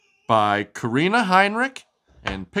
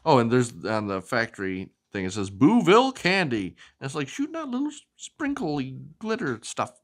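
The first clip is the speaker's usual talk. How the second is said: ordinary delivery, nothing marked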